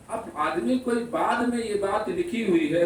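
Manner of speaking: usual speaking voice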